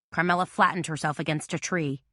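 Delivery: ordinary delivery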